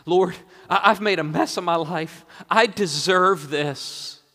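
loud, high plea of a penitent man; impassioned, remorseful appeal; pleading confession. Acted. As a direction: confess it urgently to the Lord. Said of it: "He asks".

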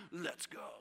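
low, hushed, understated urging; quiet call. Acted. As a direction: say it low, as if to companions nearby. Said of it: "Jesus looking around".

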